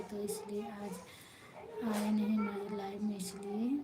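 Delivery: unvoiced, trailing sulky whisper; whispered sulk. Real, not acted. Whispering to the viewers about being upset with her brother. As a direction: breathe it out like a sulk.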